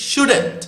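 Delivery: "shouldn't" high and loud, then falling; short form "shouldn't". Shown as a demonstration. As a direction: repeat "shouldn't" as one emphatic contraction. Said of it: "আবার বলছি".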